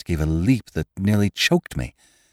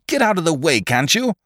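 shouting; 9 semitones higher than usual. A narrator's reading. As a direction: shout someone out of your path.

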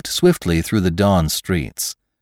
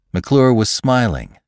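usual speaking voice

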